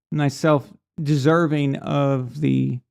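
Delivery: ordinary delivery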